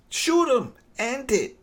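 high, insistent push to just finish it; frustrated fan shouting at the screen; exasperated urging. Retold or imitated. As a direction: egg him on, pitch jumping up.

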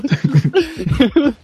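high-pitched laughing talk. Real, not acted. Laughing while describing scene.

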